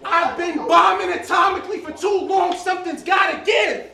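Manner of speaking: rising from strong to shouted; building shout. Acted. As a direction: build it up into a yell. Battle rap verse building up.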